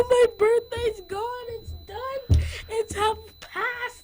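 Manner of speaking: high, loud, drawn-out lament; mock wailing. Boy imitating himself after his birthday is over, mock wailing. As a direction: wail it in exaggerated grief.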